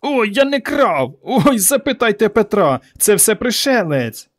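high, slow, whining protest; drawn-out high pleading; boy crying out. A narrator's reading. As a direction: wail excuses while being beaten. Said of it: "скрикував Іван".

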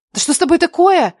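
high, alarmed question; worried. A narrator's reading. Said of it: "с беспокойством глядя на меня".

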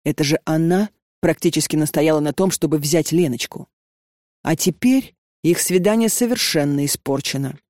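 plain reading voice

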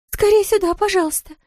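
high-pitched panicky plea; alarmed, high nurse's appeal; urgent pleading. A narrator's reading. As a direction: beg for help in a high voice. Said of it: "взмолилась она".

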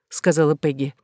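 ordinary delivery, nothing marked

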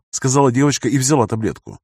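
ordinary delivery

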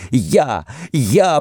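high, frantic repetition; insistent cry. A narrator's reading. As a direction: insist wildly on your guilt. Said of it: "повторяла Сара". (исступлённо, высоким голосом)

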